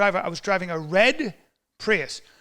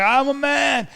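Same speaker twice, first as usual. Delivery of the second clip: loud, high protest; mock panicked cry; 7 semitones higher, 5 dB louder, slower than usual. Freezing man answers the woman, loud and high.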